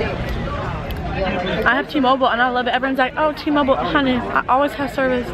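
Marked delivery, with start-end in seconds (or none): 3.2-5.3 lightly put-on drawling accent, a touch higher. Vlogger mimics friends praising T-Mobile, light put-on accent. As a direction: imitate everyone gushing about their phone in a mild put-on accent. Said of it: "I need to stop talking in that accent".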